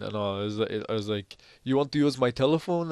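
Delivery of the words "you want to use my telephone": high-pitched mock Spanish accent; imitated Spanish accent. Suspected thief dodging the accusation, imitated Spanish accent. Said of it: "obviously Spanish accent and stuff".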